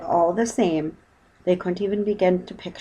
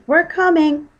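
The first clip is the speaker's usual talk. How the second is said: high, loud call; urgent high girl's call; 11 semitones higher, 8 dB louder, slower than usual. Acted. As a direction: shout reassurance across the water. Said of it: "called Judy".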